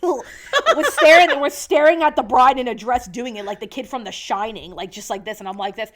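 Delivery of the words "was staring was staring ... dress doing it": high, loud, stretched out through laughter; laughing retelling. Real, not acted. Cracking up telling her co-host about the staring kid.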